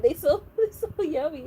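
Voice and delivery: normal talking voice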